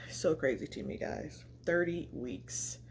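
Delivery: ordinary talk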